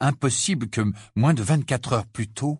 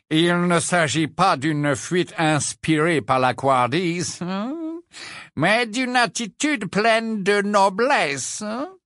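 much higher and slow, sneering; mocking old portrait; 8 semitones higher, slower than usual. Portrait sneering at Harry's noble flight, slow mock. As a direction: stretch every word out in scorn. Phineas mocks Harry's motives.

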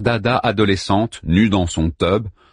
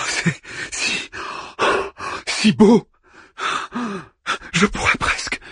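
entranced; 11 semitones higher than usual. A narrator's reading. Harman entranced, longing.

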